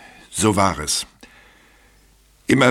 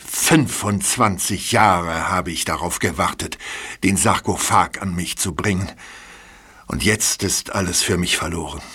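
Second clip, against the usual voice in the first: despairing; 6 semitones lower than usual. Acted. Culprit laments his loss.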